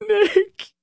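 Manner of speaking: very high sobbed cry of a name; sobbing. Acted. Leroy calling to Nick who turned away, sobbing. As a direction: cry out to him through a sob. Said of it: "Leroy sobbed".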